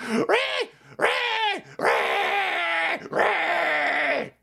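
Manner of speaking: high shrill ree screech; mock ree screech. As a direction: screech like an enraged troll. Mocking angry online screeching.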